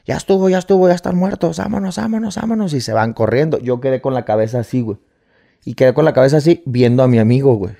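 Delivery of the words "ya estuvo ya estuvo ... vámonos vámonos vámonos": retold shout. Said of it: "alcanzo a escuchar que gritó".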